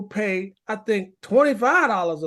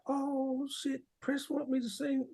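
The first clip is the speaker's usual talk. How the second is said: much softer, under his breath; a private panicked aside; muttered to self; 2 semitones higher, 11 dB quieter than usual. Retold or imitated. Inner panic walking on stage.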